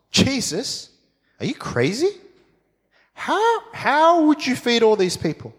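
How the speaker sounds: high, disbelieving cry to Jesus; incredulous disciple. Retold or imitated. Philip questioning Jesus, high and disbelieving. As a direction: play Philip thinking Jesus is mad.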